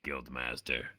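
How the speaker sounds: ordinary talk, nothing marked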